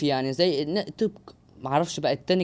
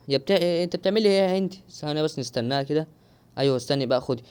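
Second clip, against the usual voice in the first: ordinary talk, nothing marked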